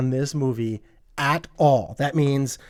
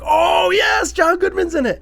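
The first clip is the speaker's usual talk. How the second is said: high, loud, thrilled cry; excited shout; 4 dB louder than usual. Retold or imitated. Reviewer retelling his joy at spotting an actor, high excited shout. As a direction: shout it like you just spotted your favourite actor. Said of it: "I'm like".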